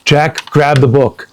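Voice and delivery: plain reading voice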